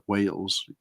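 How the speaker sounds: ordinary delivery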